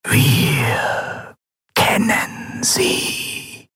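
very slow, drawn-out eerie whisper; hushed machine oracle, each word stretched out. A narrator's reading. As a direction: whisper as an ancient inhuman mask. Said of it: "flüsterte die Bronzemaske".

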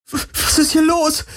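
very high, stammering panic; voice cracking. Acted. Kirai panicking at the attack, voice cracking high. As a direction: squeak it out in terror. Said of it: "Kirais Stimme überschlug sich".

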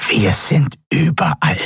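high, croaky and pressed; croaked menace from a reptile; croaking pressed voice. A narrator's reading. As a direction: squeeze it out nasal and croaking. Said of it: "krächzte die gepresste, nasale Stimme".

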